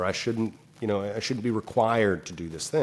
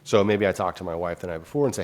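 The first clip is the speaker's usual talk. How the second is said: ordinary delivery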